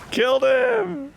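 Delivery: loud, high, drawn-out victory cry; high stretched triumphant yell; triumphant shout. Real, not acted. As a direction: crow over the kill. Victory over dragon.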